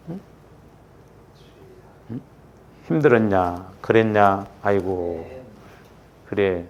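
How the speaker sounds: soft, low murmured sympathy; loving, soothing. Acted. Showing the language of love to someone worn out, tender and soothing. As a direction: drop right down and comfort them gently. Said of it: "사랑의 대화".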